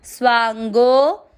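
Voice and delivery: स्वांगो with the गो drawn out long; स्वांगो, slow, final vowel held long. Shown as a demonstration. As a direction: stretch the गो in स्वांगो, slow and full. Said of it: "गो की मात्रा को लंबा खींचना है".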